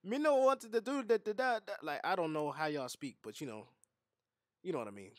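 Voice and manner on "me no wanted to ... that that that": rough imitation of a Caribbean accent, pitched up; mock Caribbean accent. Reactor imitating islanders showing their accent, mock Caribbean accent. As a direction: fumble through a guessed-at Caribbean accent. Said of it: "that's when y'all show y'all accent".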